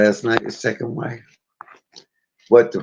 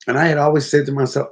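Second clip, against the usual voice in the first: usual speaking voice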